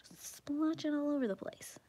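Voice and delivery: soft ASMR whisper. Real, not acted. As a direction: whisper it close to the mic.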